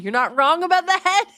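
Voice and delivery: loud high retort; excited agreement. Retold or imitated. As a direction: shout it back in agreement. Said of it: "I'm like".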